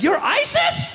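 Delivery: high-pitched imitation of a stunned child; hammed-up child's shocked question; horrified little kid. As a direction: squeal it in mock horror like a six-year-old. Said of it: "six-year-old kid from Wisconsin".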